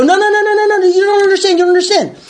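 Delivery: high-pitched desperate no no no; frantic protest. Retold or imitated. To the tow truck guys, a frantic high-pitched protest. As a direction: beg them frantically, voice shooting up. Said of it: "I go".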